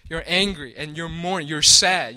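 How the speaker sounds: usual speaking voice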